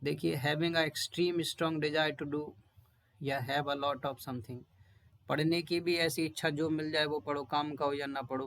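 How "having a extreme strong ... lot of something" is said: definition read slowly and evenly; slow classroom reading; slow dictation. Shown as a demonstration. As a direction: read the meaning out slowly for note taking. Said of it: "यहाँ पर देखिए".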